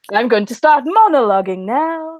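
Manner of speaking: theatrical bad-guy proclamation; mock villain. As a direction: grandly announce it like a hammy villain. Mimicking a monologuing movie villain.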